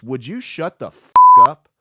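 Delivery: loud, exasperated retold outburst; audience member's retort. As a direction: raise it sharply, as if silencing a heckler. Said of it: "looks at her and goes".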